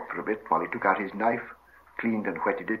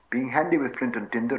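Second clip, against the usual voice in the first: usual speaking voice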